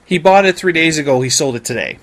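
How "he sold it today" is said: ordinary talk, nothing marked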